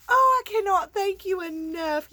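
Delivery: high, loud, choked-up thanks; tearful gratitude. Real, not acted. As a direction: gush thanks through happy tears. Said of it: "now that I'm squalling".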